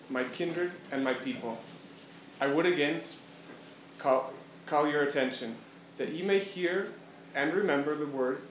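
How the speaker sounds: plain reading voice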